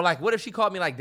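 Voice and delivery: usual speaking voice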